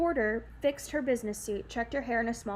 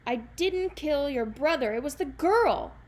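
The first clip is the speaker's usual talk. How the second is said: desperate cry; 4 semitones higher, 7 dB louder than usual. Acted. Jack cries out to the giants, loud desperate denial. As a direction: cry out desperately to shift blame. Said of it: "the young man cried".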